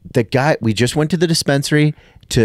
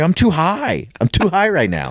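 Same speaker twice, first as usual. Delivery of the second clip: panicked confession; 9 semitones higher than usual. Retold or imitated. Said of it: "I was like".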